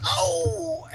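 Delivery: high, sudden mock yelp of surprise; startled yelp. Dad jolted as the dog launches off him, high yelp. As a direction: squeal like a dad jolted off his chair. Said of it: "my dad goes".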